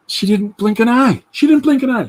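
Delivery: loud, high, emphatic; emphatic retelling. Retold or imitated. Telling friends about the ID check, loud and high. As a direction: hammer home that she didn't care.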